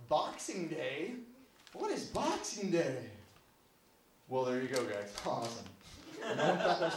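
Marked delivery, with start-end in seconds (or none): 0.1-3.1 drawn-out, incredulous question; baffled disbelief. Acted. Customer finds the bank closed for a holiday, baffled. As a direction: stretch it out, totally baffled.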